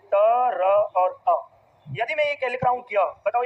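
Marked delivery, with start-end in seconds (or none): 0.1-1.4 "त, र और अ" very slowly, each sound held apart; sounding out letters. Shown as a demonstration. Teacher splitting a conjunct for students, "त, र और अ" slowly isolated. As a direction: stretch each sound of "त, र और अ" separately. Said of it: "automatic रूप से वर्ण क्या निकलता है".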